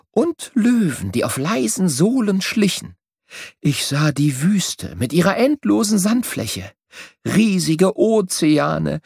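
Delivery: bright, lively voice of a small bird telling his adventures; the travelling bird. A narrator's reading. Travelling bird tells Merle his journey, lively and bright. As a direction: tell it like a proud little bird who saw the world.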